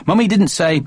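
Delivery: ordinary delivery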